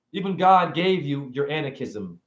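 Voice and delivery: ordinary talk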